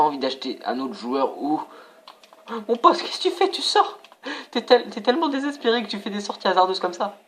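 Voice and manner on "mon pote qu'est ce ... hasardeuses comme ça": much higher, teasing disbelief; mock the keeper's wild rush out; incredulous. Real, not acted. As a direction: gamer scolding a reckless keeper. Keeper rushes out badly.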